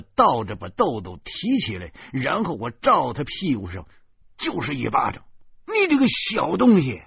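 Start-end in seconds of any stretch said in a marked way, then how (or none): 5.7-7.0 high, loud furious scolding; angry scolding. A narrator's reading. Narrator scolding Doudou after the bedwetting, high and loud. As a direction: shout down at the boy.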